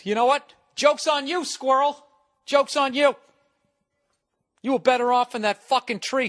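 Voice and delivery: high, loud taunt at the squirrel; yelling at squirrel. Real, not acted. Taunting the squirrel that moved in downstairs, loud mocking yell. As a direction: jeer it down through the floor.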